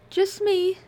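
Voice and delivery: high, light woman's voice calling out. Acted. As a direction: call back lightly as a young woman. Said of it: "a woman's high voice called back".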